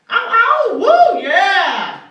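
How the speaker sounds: high, drawn-out wolf-howl whoop; whooping, stretched-out catcall; loud catcall. Acted. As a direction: holler a catcall at full volume. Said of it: "catcall at him".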